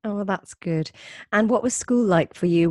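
ordinary delivery

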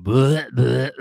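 loud mock retching; cartoonish throwing-up sounds; imitated vomiting. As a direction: heave out fake puking noises. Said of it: "And then you hear him".

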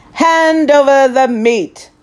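loud, high roaring demand; whole crew roaring for meat; pirates roaring. Acted. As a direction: roar it like hungry pirates. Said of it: "we all roared".

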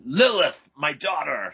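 high, loud cry of recognition; dramatic reveal, called out; acted astonishment. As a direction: exclaim it like a shocked comic-book father. Acts startled line from comic panel.

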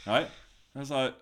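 normal talking voice

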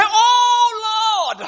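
high, loud excited shout. Shown as a demonstration. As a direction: cry it out with joy. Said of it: "say it like I was excited about it".

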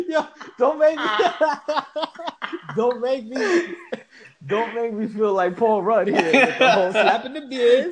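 high-pitched, talking through laughter; laughing. Real, not acted. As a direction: crack up while trying to get it out. Cracking up over failed accent.

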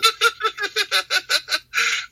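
a high, long burst of laughter. Real, not acted. Teasing about mini golf.

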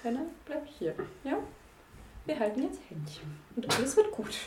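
very soft, soothing voice; hushed reassurance. Acted. Player takes a friend's hand to keep her back, softly reassuring. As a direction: comfort her quietly and keep her close.

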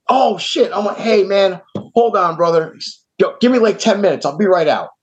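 high, eager apology; sudden friendly turn. Retold or imitated. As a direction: switch to eager and welcoming. Jerry suddenly turns friendly.

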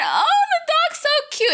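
put-on high, gushing baby-talk coo; excited coo. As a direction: coo at the cute dog, way up high. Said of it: "And I said".

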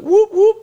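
high-pitched whoop whoop siren; two quick siren whoops; siren imitation. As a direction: copy the cop siren, way up high. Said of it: "like, sirens, like".

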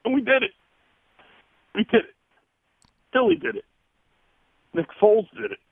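high, overjoyed cry of victory; a fan's voice lifting with pride; emotional joy. Real, not acted. Caller tells the host his team won, overjoyed. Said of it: "You hear the emotion in his voice".

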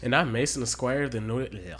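loud hammed-up Australian intro; a half-hearted Australian twang, loud; bad Australian accent. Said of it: "The worst Australian accent ever".

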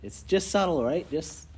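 ordinary talk

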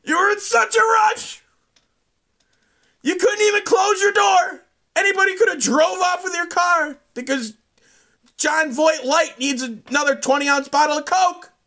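high, loud yell across the parking lot. Retold or imitated. Yelling after the rude driver across the parking lot, loud and high. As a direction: shout it after him from far away. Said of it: "yelled at him from across the parking lot".

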